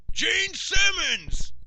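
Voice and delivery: high, drawn-out heckling shout; imitated heckler's yell. Fan a couple rows back heckles the host, yelling. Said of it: "is just yelling".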